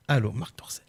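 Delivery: hushed aside whisper. Real, not acted. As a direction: whisper it under your breath. Quiet before a prank call.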